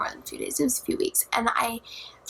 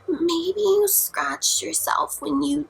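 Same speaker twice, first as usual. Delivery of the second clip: slow, higher mock-dad voice; doubting dad; 6 semitones higher, much slower than usual. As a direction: imitate a skeptical parent, drawn out. Acts out dad dismissing her.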